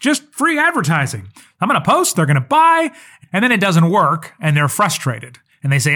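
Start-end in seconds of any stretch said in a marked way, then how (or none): 0.0-2.9 much higher, hammed-up delight over free advertising; mock giddy excitement. Naive business owner gushing to the listener, mock giddy excitement. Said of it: "So they go".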